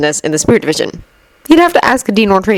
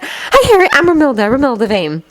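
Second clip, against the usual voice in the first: confident; 8 semitones higher than usual. A narrator's reading. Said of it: "said loudly and with great confidence".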